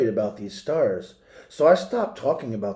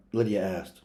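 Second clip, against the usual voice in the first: ordinary delivery, nothing marked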